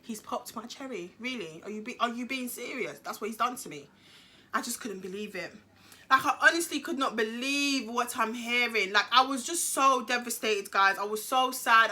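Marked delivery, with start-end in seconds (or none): 0.0-5.5 much softer, low stunned disbelief. Retold or imitated. Teen repeating the news to herself in soft shocked disbelief. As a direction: say it hushed, in shock. Stunned after cherry popped.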